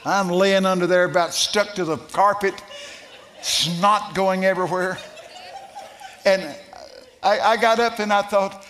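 loud, high climactic preaching; preaching at full force. Acted. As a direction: build the story to a roar.

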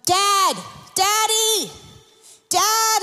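put-on a child crying out for dad; retold yelling for dad. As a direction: call after your father as he drifts away. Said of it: "I start yelling".

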